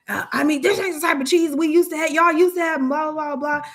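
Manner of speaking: high, loud, fast mock complaint; angry customer. Irate customer complains about the new cheese, mock rant.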